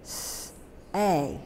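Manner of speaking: "S" as a soft hiss, then "A" alone; separate sounds. Shown as a demonstration. Teacher letting out say, hissed "S" then a separate "A". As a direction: sound out "S, A" with a gap between. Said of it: "Let it out".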